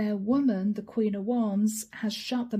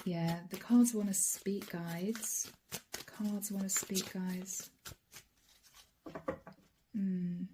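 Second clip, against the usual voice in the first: real quiet talk; 3 semitones lower, 7 dB quieter, slower than usual. Real, not acted. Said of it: "I'm not talking very loudly".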